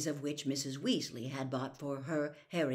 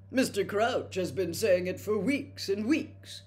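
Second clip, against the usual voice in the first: swiftly; 5 semitones higher, 6 dB louder than usual. A narrator's reading. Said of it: "said Percy swiftly".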